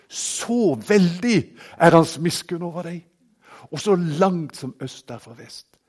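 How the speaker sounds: ringing sermon delivery; full-force preaching. Acted. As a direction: proclaim it to the whole congregation. Preaching swell on scripture.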